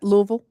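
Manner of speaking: "Louisville" squashed to LUH-vul; local pronunciation. Shown as a demonstration. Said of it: "you should understand that".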